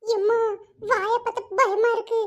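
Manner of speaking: ordinary talk, nothing marked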